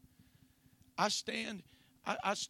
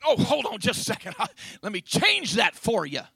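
loud, higher-pitched sarcastic accommodation; mock obliging; 5 semitones higher, 13 dB louder than usual. Preacher playing God answering an objector, mock obliging. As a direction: pretend to jump up and oblige, loudly. Mock reply to refusing truth.

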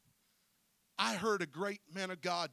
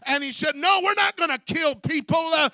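full-force preaching; 9 semitones higher, 17 dB louder than usual. Real, not acted.

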